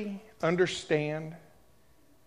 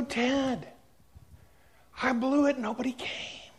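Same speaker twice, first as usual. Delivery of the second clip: higher and softer, choked up; tearful confession; 6 semitones higher, 8 dB quieter than usual. Retold or imitated. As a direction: say it through tears. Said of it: "I'm still crying".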